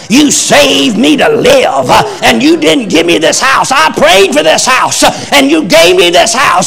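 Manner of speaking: woman on her knees crying out to Jesus as the storm comes; fervent shouted prayer. Retold or imitated. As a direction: pray it out like a Pentecostal preacher, full force. Retold prayer preached out loud.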